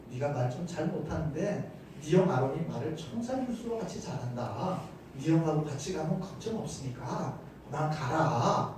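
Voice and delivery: soft, low-key reassurance; calm, gentle tone. Shown as a demonstration. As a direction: reason with them quietly and patiently. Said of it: "차분하게 이야기할 수도 있어요".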